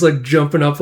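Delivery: plain reading voice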